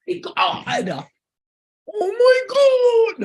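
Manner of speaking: sudden high cry of disbelief; a real startled yell breaking out; shocked cry. Real, not acted. Startled by something while reading to viewers, a high loud cry.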